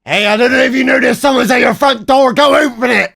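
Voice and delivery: high, loud shout at the screen; loud, high mock shout; mock warning shout. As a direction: yell a warning to someone who can't hear. Yelling to woman in video.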